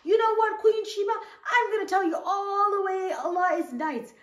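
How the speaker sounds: high, loud mock-bossy royal announcement; hammed-up king barging in to lecture the queen. The prophet marching in on Queen Sheba, in a high, loud mock-bossy voice. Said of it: "been like".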